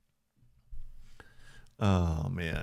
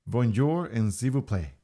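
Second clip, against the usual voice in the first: hammed-up French; 3 semitones higher than usual. Greeting the stream chat in a hammed-up French accent. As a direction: play up a cheesy French accent. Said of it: "speak multiple languages very badly".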